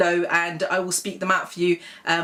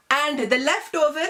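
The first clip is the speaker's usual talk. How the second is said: put-on Desi accent; 5 semitones higher than usual. Parent saying leftovers go in the "pridge", Desi accent. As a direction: say "fridge" like "pridge". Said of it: "meaning the fridge".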